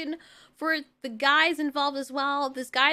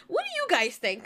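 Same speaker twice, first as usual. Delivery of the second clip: higher, helplessly laughing question; uncomfortable laughing; 4 semitones higher than usual. Real, not acted. Asking viewers what they think, with an uncomfortable laugh. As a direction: ask through an awkward laugh. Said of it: "I don't know why I'm laughing".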